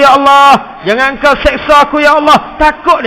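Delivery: high, loud pleading sermon; impassioned preaching with prayer; fiery preaching. Acted. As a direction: cry out the prayer for mercy. Preaching at full force.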